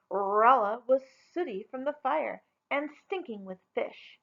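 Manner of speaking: plain reading voice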